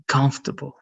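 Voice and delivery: "comfortable" shortened to KUMF-ta-bul; "comfortable" said in three syllables, comf-ta-ble; reduced comfortable. Shown as a demonstration. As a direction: drop the OR in "comfortable". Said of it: "you have to say".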